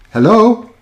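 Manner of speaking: high questioning hello; checking the line. Real, not acted. Host urging a caller to answer, questioning. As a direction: pitch it up to reach someone on the line.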